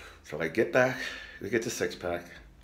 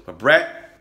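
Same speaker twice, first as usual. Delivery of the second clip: loud, rising call, louder each line; 6 semitones higher, 8 dB louder, slower than usual. Real, not acted. Comedian yells a debt reminder at Bret, loud building call. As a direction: shout it across the room at him.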